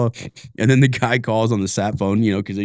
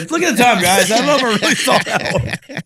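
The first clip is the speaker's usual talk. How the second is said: much higher, put-on voice for a weaselly dodge; high mock excuse to skip the hike; mock sheepish excuse; 11 semitones higher than usual. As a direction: play the guy who wants out, hammed-up. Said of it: "you're like".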